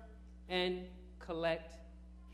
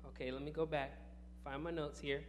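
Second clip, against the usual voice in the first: normal talking voice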